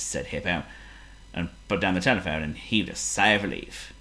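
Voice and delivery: usual speaking voice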